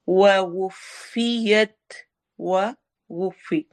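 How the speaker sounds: slow with rounded lips; Quran recitation. Shown as a demonstration. Teacher demonstrating rounded vowels, slow. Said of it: "दोनों में होंठ गोल होंगे".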